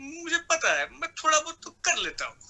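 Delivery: very high, sweet mimicked voice; Jeetendra. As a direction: answer in a sweet, high-pitched film-hero impression. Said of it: "एक line और बोलिए ना आप".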